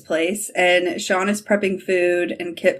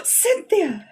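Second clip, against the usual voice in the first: gasped higher exclamation of recognition; excited recognition; 9 semitones higher than usual. Retold or imitated. Fan recognising actress onscreen, gasped excited cry. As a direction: call the name out as if spotting her.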